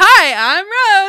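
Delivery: high, loud mock greeting. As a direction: belt out a cheery hello. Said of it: "She's like".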